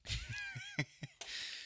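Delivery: high burst of real laughter; sudden giggle breaking out; laughs. Real, not acted. Streamer cracking up at the fight, high laugh.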